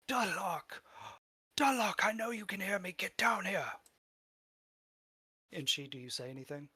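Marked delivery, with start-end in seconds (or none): 0.1-3.7 high, loud shout calling someone down; calling out furiously to an underling; angry summons. Acted. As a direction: bellow the name, then order them down. Character calls for someone elsewhere.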